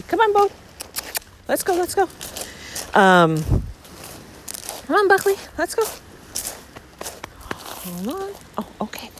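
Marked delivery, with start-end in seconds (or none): 0.0-2.0 high sing-song call to the dog. Real, not acted. Calling the dog on a walk. 4.9-5.8 high sing-song call to the dog. Real, not acted. Calling the dog on a walk.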